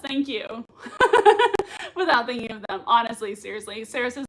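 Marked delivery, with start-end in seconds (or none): 0.5-1.9 high laugh. Real, not acted.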